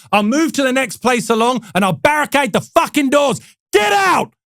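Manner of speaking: retold tirade, shouted in the CEO's voice; mock angry shout. CEO throwing the salesmen out, mock angry shout.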